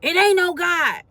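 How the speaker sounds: high, loud mimicking yell at his mother; retold yell. Son yelling at his mother that God is not real, loud mock yell. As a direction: replay his defiant shout, a bit hammed-up. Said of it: "and he would yell".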